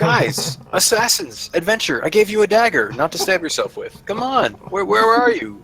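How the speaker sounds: high-pitched, urgent coaxing; exasperated pep talk. Acted. In character, rallies reluctant escorts.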